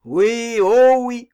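loud, rising mock assent; scoffing agreement. Captain mocking the young man's grim airs, scoffing agreement.

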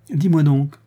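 irritable, insistent opening; ill-humoured. A narrator's reading. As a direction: stop someone with a cross question. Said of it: "demanda-t-il avec humeur".

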